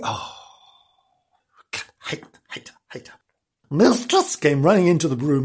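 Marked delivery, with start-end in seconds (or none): 0.0-3.1 hissed whisper of hate. A narrator's reading. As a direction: breathe the words like a secret curse. Narrator whispers hatred.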